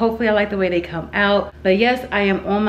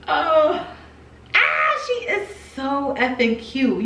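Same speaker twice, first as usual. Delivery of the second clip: excited; 6 semitones higher, much slower than usual. Real, not acted.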